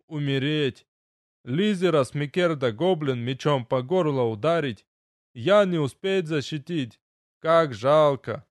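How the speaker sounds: a dwarf grieving in clumsy words; gruff gravelly dwarf voice. Acted. Dwarf speaks broken speech.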